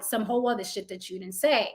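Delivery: usual speaking voice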